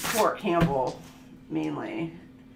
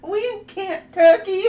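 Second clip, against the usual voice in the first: higher, loud Kentucky said through laughter; laughing imitation of daughter; 11 semitones higher, 8 dB louder than usual. Mom imitating daughter's Kentucky, laughing hard. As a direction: mimic the kid while cracking up. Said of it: "that's how she would say it".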